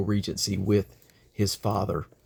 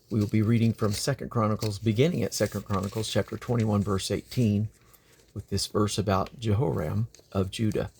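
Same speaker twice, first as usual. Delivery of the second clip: usual speaking voice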